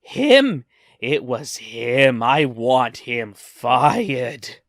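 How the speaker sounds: a sharp cry on "Him!", then slow, heavy demands; hammed-up outrage pointing out the culprit; ranting customer. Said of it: "and she's ranting".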